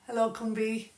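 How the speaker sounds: usual speaking voice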